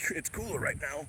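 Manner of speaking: lightly done wrestler voice; Hulk Hogan voice. Pretend. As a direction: growl it like a pro wrestler. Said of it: "This is my Hulk Hogan voice".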